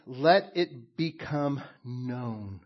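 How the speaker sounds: very slow, each word weighted; slow, deliberate quote. Shown as a demonstration. Pastor quotes Paul to the church, very slow and deliberate. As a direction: let the words sit, slowly. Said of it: "he says".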